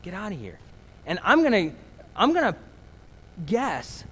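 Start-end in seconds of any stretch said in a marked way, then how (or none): 0.0-0.6 soft dismissive brush-off. Retold or imitated. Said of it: "something I kept saying".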